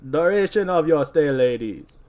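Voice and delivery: mocking echo of a put-on accent; sneering, exaggerated mimicry. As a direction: parrot it back mockingly, drawn out. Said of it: "in a mocking tone".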